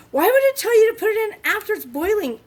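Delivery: venting at the recipe; frustrated outburst. Real, not acted. Ranting at the recipe instructions.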